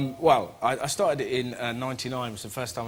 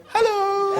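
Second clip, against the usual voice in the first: very high, stretched squeak of hello; squeaky hello. Retold or imitated. Caught crawling from under the bed, squeaky high hello. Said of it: "I just looked at her and went".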